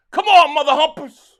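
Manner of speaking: loud, high shout at the team; frustrated yell. Real, not acted. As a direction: yell it at the screen like a fan. Cheering a football play.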